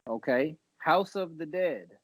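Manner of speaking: ordinary talk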